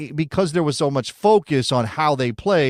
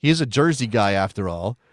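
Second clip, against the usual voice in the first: ordinary talk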